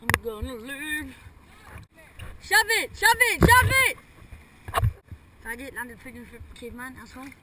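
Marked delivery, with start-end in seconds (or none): 2.4-4.0 loud rising chant of shove it; shouting. Real, not acted. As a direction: yell it louder each time. Yelling at friend on wakeboard.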